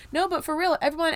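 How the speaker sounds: high emphatic exclamation. Real, not acted. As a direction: punch it up high. Emphatic turn.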